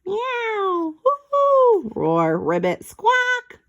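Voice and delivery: high imitated animal noises; playful imitated zoo noises; animal sounds. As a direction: mimic each animal in turn. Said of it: "strange animal sounds".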